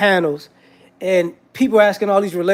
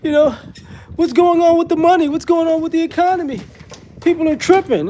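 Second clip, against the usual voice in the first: high-pitched whiny complaining; the same people who wanted tariffs, now whining about the money; mock whining; 9 semitones higher than usual. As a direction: whine it like a helpless complainer. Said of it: "same people whining".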